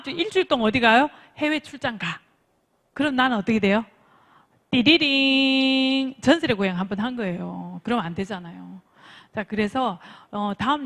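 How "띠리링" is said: long drawn-out "ta-da-ring" sound effect; imitated jingle. Shown as a demonstration. Coach mimicking a spooky show's sting, drawn-out jingle. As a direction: sing out a jingle, holding the last note.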